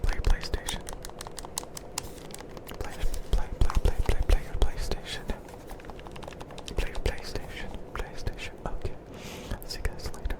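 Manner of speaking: faint far-off whisper; right in ear. Acted.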